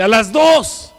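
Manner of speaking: loud, high call; shouted count. Retold or imitated. Quoting the man's countdown. (conteo gritado con fuerza)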